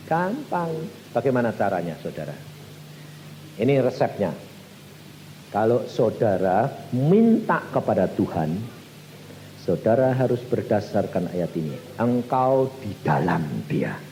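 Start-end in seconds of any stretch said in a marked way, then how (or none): none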